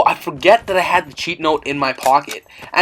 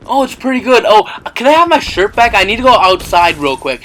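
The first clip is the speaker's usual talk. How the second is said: higher, brisk fake-innocent request; breezy cover-up, pitched up; feigned casualness; 6 semitones higher, 4 dB louder than usual. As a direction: act casual to get something back from mom. Acting casual to mom, covering panic.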